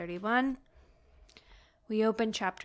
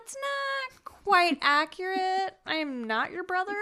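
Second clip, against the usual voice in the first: deadpan correction; 8 semitones higher, 3 dB louder than usual. Retold or imitated. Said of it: "She's like".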